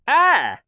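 high, loud pained scream; piercing shriek. Acted. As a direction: scream in sudden pain. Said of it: "a piercing shriek".

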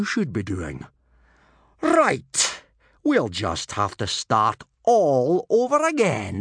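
lightly voiced sarcastic teacher; sarcastic Gobber. A narrator's reading.

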